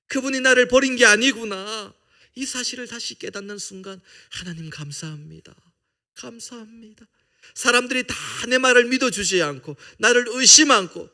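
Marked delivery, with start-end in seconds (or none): 0.1-1.9 exclaiming. Acted. Preacher's sudden realisation cry. 7.5-11.1 impassioned. Acted. Preaching build-up.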